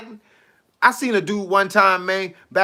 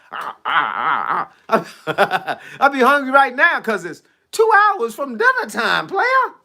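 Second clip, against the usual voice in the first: laughing; 4 semitones higher than usual. Real, not acted. Laughing about his hunger.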